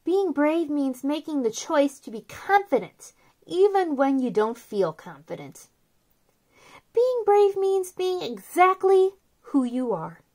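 soft, gentle read-aloud of a picture book; storybook reading. Acted. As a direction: read it softly to little kids, like a bedtime book.

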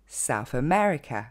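South America à l'anglaise, ME plus marqué que South; accent secondaire sur South. Shown as a demonstration. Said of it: "va recevoir un accent tonique secondaire". (South America, double accent)

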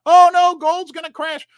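high, loud hammed-up alarm; fake-panic, like the sky is falling; mock panic. Host mocking the gold-crash headline, hammed-up panic.

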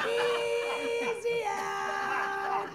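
high, slow drawn-out heckler chant; heckling child. Retold or imitated. Mom imitating toddler heckling her awake like a fan. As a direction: jeer like a fan in the stands, slow. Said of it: "Like he's athletic heckling me".

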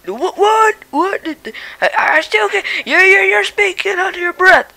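cranky, hard-of-hearing grandpa voice; a deaf old dad barking back; grumpy old man. Acted.

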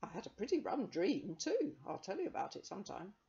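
put-on cheerful young man. Young man telling the children about his dream, hearty. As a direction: chat breezily like a jolly chap. Young man's voice, several lines.

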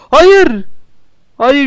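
high, loud dismayed yell; shouting no. Real, not acted. Platform fails, yelling.

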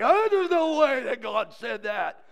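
loud, slow, high mock outrage; hammed-up shocked churchgoer; scandalised Baptist. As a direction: protest in exaggerated disbelief. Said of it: "y'all be like".

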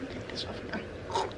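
breathy whisper to herself; a soft unvoiced murmur; whispered aside. Real, not acted. Muttered aside while removing mic.

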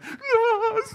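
high-pitched mock wail; imitated bawling. Preacher mimicking a lost boy bursting out crying, mock wail. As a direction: burst into a child's loud bawl, mimicked. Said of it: "he started crying, bawled his eyes out".

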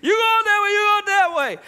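very high, loud orders; frantic pointing. Retold or imitated. Mother sending kids each way, frantic and loud. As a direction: hurry them off in panic. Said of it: "we don't know what east and west".

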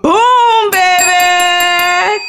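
a big celebratory cheer; excited shout. Real, not acted. Cheering a viewer's match on the scratch ticket, loud excited shout. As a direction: boom it out high and loud, thrilled.

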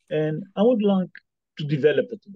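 ordinary delivery

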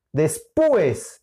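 después as three syllables, des-PÚ-es; wrong stress on U. Shown as a demonstration. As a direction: punch the u in después, splitting it from e. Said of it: "si le colocas en la U diría después". (después con hiato y fuerza en la u: despúes)

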